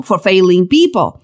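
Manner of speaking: ordinary talk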